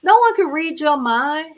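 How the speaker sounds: ordinary delivery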